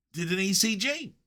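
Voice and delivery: ordinary talk, nothing marked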